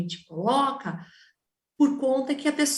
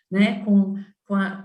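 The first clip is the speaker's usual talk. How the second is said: usual speaking voice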